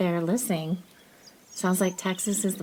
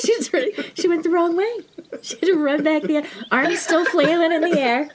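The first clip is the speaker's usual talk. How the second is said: high, loud, laughing storytelling; hysterical retelling; 11 semitones higher, 5 dB louder than usual. Retold or imitated. Host tells of mom running back, hysterical retelling. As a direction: cry it out at a mock-frantic high pitch. Acting out mom's frantic return.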